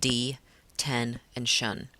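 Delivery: D, ten, and shun spaced out with pauses; split into syllables. Shown as a demonstration. Teacher counting syllables for the class, each one separate. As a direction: break detention into D, ten, shun. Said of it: "comes up with three syllables".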